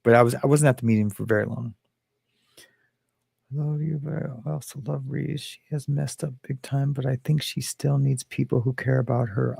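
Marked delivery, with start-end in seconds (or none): 3.5-9.6 reading quietly. Acted. Reading a viewer comment quietly.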